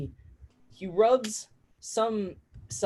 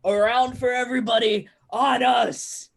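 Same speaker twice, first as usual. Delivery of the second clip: raised, loud drink order; rowdy call to bar; 5 semitones higher, 5 dB louder than usual. Acted. Impressed drinkers buying everyone a round, rowdy shout. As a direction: call it out high across the room.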